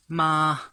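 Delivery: ma held high and level; first tone ma. Shown as a demonstration. Teacher showing first tone ma meaning mother. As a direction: say ma high and flat. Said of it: "high and flat tone".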